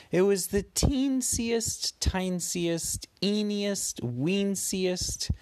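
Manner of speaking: high, slow, tiny voice; tiny squeaky voice. A narrator's reading. Cute diminutive list for child.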